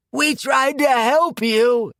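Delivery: high, slow, wailing plea; crying out. Acted. Marcia burning, crying out to Quinn, a high slow wail. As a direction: wail it as you burn. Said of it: "cried Marcia".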